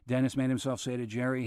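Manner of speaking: plain reading voice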